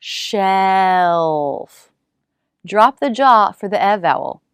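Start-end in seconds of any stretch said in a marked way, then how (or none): 0.0-1.9 "Shelf" stretched slowly, dark L held; slow drawn-out shelf. Shown as a demonstration. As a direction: draw "Shelf" out to show each sound. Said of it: "dark L".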